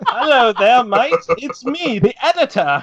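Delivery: high, hammed-up British voice; hammed-up Brit impression, pitch up; British accent impression. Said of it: "favorite British brony".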